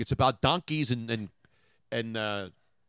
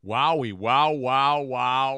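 mock commercial jingle; 3 semitones lower, 4 dB louder, slower than usual. Mocking the toy commercial jingle.

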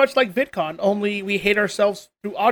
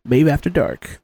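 deep, mic-close crooning streamer voice; late-night stream voice; 5 semitones lower than usual. Shown as a demonstration. Still in the Maeve After Dark voice for his co-host. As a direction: stays in the after-dark persona.

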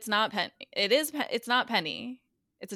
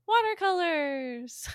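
higher, slow spaced-out delivery; dreamy odd voice; 8 semitones higher, much slower than usual. Retold or imitated. Quoting Quentin's strange reply, slow and higher. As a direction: float the word like someone adrift.

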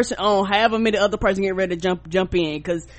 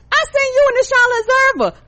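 very high, louder excited voice; mimicking cousin; 6 dB louder than usual. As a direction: announce it like the proud cousin. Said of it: "She said".

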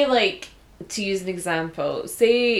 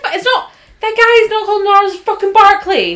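high, loud exasperated insistence; high, loud, worked-up outburst; retold exasperation; 13 semitones higher, 12 dB louder than usual. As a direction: pitch it up and belt it out in disbelief. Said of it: "I was just like".

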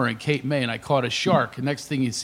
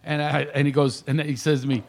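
normal talking voice

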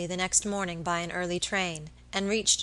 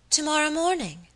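higher-pitched, startled echo; surprised exclamation; 8 semitones higher than usual. A narrator's reading. My lady hears of her guest's departure, surprised exclamation. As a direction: exclaim it as a sudden, high question. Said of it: "exclaimed my lady, looking up suddenly".

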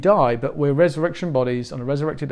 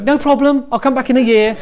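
much higher, overly agreeable pushover voice; meek old self; 10 semitones higher, 7 dB louder than usual. Retold or imitated. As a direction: play the timid people-pleaser, brightly higher. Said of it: "the old [name] would be like".

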